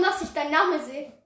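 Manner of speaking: ordinary talk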